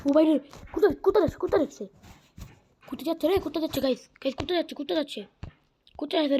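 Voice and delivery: high, hurried alarm as the dog runs off; alarmed calling. Real, not acted. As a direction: chase it with your voice, urgent.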